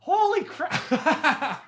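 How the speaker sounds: high, loud, breaking into laughter; amused disbelief. Real, not acted. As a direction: stretch it out and crack up. Reacts to everyone's near-death stories, laughing.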